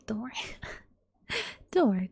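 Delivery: ordinary talk, nothing marked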